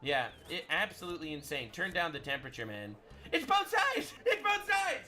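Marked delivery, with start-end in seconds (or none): 3.3-5.0 high, loud mocking shout of a liberal; mock shrill cry. Mocking his critics with a high shout. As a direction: yell it like a whining opponent.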